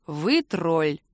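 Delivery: low, drawn-out weary verdict; resigned sigh. A narrator's reading. Man to the lover teasing him, resigned. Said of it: "констатировал со вздохом".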